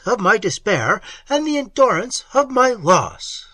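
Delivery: high, loud, frantic begging; wild pleading. A narrator's reading. A desperate lover begging her to stay, high and loud. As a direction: beg her wildly not to leave. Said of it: "wildly interrupting her".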